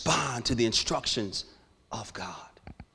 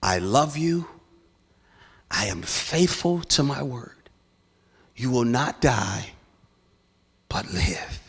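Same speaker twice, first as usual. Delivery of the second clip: voice of God. Retold or imitated. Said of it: "the voice of God tell me this".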